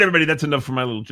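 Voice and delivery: ordinary talk, nothing marked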